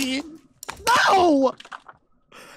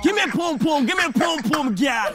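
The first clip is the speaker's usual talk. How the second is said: mock Jamaican patois. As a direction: shout it like a cartoon bad man chatting up a girl. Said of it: "Jamaica, you know".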